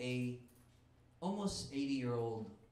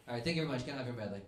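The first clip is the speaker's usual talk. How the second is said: normal talking voice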